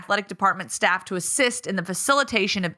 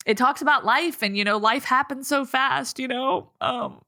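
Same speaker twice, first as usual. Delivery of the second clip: high voice, fading as tears rise; fighting back tears; 5 semitones higher than usual. Real, not acted. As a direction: push on with the thought while choking up. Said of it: "y'all, this is not good".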